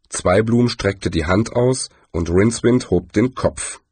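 ordinary delivery

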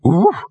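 high yelp of oof; a quick dog yelp; short bark. Acted. Dog's bark.

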